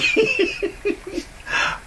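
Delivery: breathy high laughter. Real, not acted. Laughing about her day.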